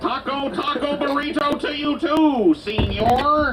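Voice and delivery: put-on Chicano accent. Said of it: "Can you do a Chicano accent?".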